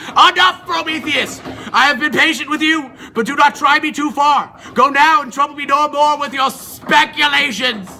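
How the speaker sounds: loud, commanding rebuke from the king of gods; furious god silencing a defiant Titan; angry Zeus. Acted. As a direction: thunder it down at him, high and loud. Zeus rebukes Prometheus angrily.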